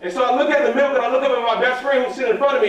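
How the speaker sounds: ordinary talk, nothing marked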